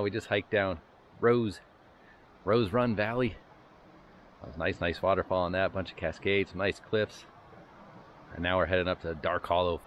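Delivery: ordinary talk, nothing marked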